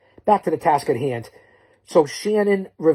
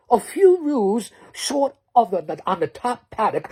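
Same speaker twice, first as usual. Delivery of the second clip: mock Aussie accent; 5 semitones higher than usual. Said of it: "impersonations, I can do it all".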